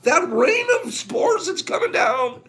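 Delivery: high, tearful, half laughing; laughing through tears. Real, not acted. Teacher reading to students, laughing through tears. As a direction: choke up and laugh at once. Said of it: "getting carried away".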